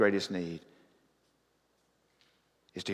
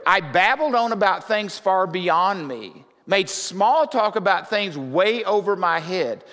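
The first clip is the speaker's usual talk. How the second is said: loud, high preaching voice, pressing every line; full-force preaching; 9 semitones higher, 7 dB louder than usual. Acted. Pastor preaching on Job to his congregation, full force. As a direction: preach it to the rafters.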